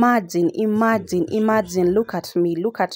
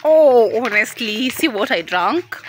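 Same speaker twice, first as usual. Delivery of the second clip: giddy tipsy; 5 semitones higher than usual. Real, not acted. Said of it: "I'm tired now".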